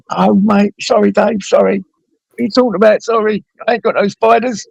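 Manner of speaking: high, flustered apology to Dave; coming to his senses, sheepish; apologetic snap back to reality. Retold or imitated. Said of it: "and then you".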